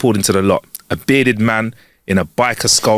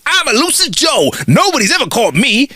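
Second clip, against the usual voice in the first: pitched-up cowboy holler; screaming cowboy; 13 semitones higher than usual. Acted. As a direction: announce yourself like a show-off gunslinger. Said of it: "another cowboy bursts through ... and screams".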